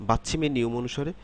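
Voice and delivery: ordinary delivery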